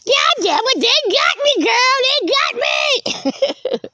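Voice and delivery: very high cartoonish wail; hammed-up high whine, stretching the end; mock jailbird lament. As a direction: mimic someone caught, in squeaky falsetto. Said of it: "Your ass going to jail".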